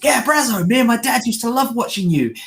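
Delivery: high, gushing fan voice; eager fan. Retold or imitated. Fan telling Steve Martin's character about his dad, high and gushing. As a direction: gush about watching him with dad. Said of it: "He's like".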